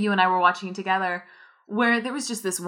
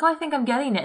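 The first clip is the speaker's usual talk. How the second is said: hopeful realisation; 7 semitones higher, faster than usual. Retold or imitated. Retold hopeful language learner.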